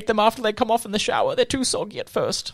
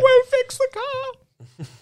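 high, slow, drawn-out character voice; squeaky slow put-on voice; silly high voice; much slower than usual. Same silly voice leaving.

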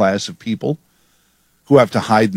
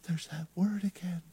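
breathy high whisper, much softer; whispered aside; 6 semitones higher, 20 dB quieter than usual. Real, not acted. Host whispers a pointed aside to his radio audience. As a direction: whisper it like a knowing aside to listeners.

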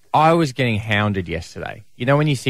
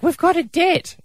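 much higher put-on voice of a worried wife; imitating wife. Husband mimicking his wife's worried phone call, high put-on voice. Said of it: "She's like".

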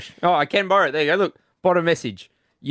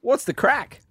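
light Irish lilt, raised pitch; a hint of an Irish accent; 8 semitones higher, slower than usual. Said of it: "are you doing the accent".

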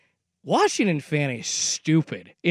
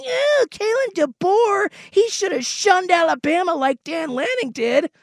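mocking Husky fan; 12 semitones higher than usual. Host mocks Husky fans with a high whiny whine. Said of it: "Husky fan, turn it around, be like".

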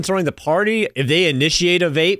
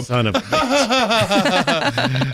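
laughing; 6 semitones higher, much slower than usual. Real, not acted. Laughing at the absurd mix-up.

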